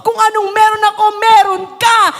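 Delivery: fiery revival preaching; preaching at full force. Acted. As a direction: preach it to the rafters over music. Preaching climax continues.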